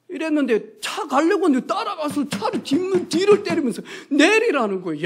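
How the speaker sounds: usual speaking voice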